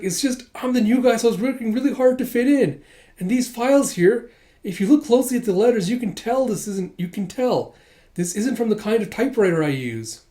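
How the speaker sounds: high, earnest nervous excuse; hammed-up innocent victim; flustered pleading. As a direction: plead your case insistently. Retold new guy pleads his case.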